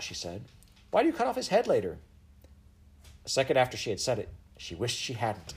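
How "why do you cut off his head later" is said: high, quick, innocent blurt; blurted girl's question. Acted. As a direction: ask it too quickly, guilelessly.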